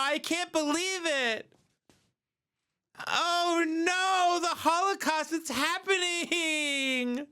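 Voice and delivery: high-pitched hammed-up wailing; mock panic. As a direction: wail in fake terror, far up in pitch. Mock victim screaming in panic.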